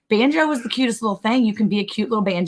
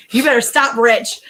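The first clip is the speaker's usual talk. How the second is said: mock scolding; 4 semitones higher, 5 dB louder than usual. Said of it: "Monica was".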